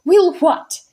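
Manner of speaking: haughty. Acted. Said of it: "said Miss Persimmon haughtily".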